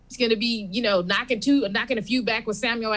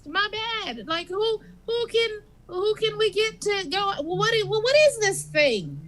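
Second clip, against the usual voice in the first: Bob the clerk; 9 semitones higher than usual. Acted. Continues the high clerk voice.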